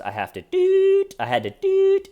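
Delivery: very high, loud squeak; squeaky silly voice. Retold or imitated. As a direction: squeal the confession like a cartoon. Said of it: "makes it funnier".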